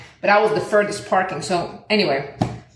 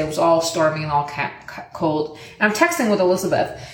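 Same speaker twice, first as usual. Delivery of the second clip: normal talking voice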